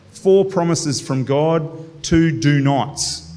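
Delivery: slow, spelled-out count. Shown as a demonstration. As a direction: lay out each number slowly. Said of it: "counting the verse's parts".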